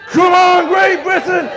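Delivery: high, loud stadium yell; the crowd urging Great Britain on at the finish; crowd shouting. Retold or imitated. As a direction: bellow the cheer like a packed grandstand. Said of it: "I can also hear the crowd shouting".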